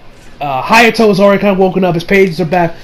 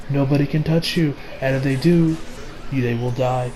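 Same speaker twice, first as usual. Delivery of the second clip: low, soft, measured threat; menacing villain; 5 semitones lower, 7 dB quieter than usual. Retold or imitated. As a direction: explain the trap quietly and coldly. Voicing the villain's cold threat.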